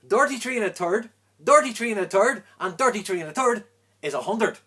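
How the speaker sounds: loud, high Irish punchline; loud triumphant Irish brogue; Irish accent. Acted. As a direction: hammer each dirty in a broad Irish brogue.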